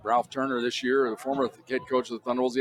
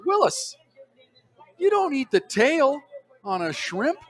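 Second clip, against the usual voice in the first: mock scolding teammate; 12 semitones higher, 4 dB louder, slower than usual. Teammate's scolding quote retold.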